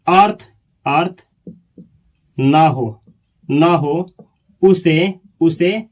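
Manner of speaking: slow, word by word, for students to write; slow dictation. Shown as a demonstration. Teacher dictating the definition slowly to students. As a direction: spell it out slowly with pauses. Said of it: "देखिएगा".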